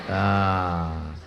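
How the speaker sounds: ordinary delivery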